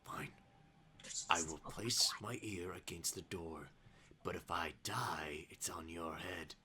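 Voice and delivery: low, very quiet guard voice; reluctant guard. Acted. Guard agreeing to eavesdrop, low and hushed. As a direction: sink into a hushed, near-whispered warning. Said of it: "The guard looks to you".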